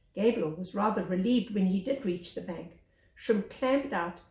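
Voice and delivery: ordinary delivery, nothing marked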